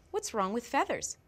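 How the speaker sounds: normal talking voice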